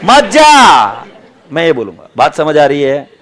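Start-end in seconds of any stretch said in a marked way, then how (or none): 0.0-1.0 long drawn-out high yell; exasperated shout. Acted. Coach ordering a whining swimmer not to go, exasperated shout. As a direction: stretch the words in a loud, slow mock bellow.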